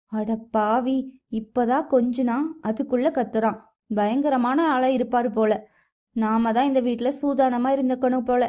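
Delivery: ordinary delivery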